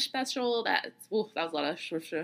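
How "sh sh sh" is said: slushy lisp on sh sounds. Real, not acted. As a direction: lisp from teeth just removed. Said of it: "excuse the lisping".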